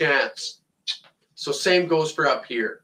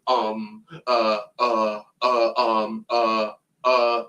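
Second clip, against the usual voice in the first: lower, halting ums and uhs of a mock Trudeau; mock hesitant politician; 4 semitones lower, much slower than usual. Mocking Trudeau stalling in every sentence, lower, halting fillers. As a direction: stumble through the fillers like a clueless leader. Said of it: "can't rattle off a sentence without saying".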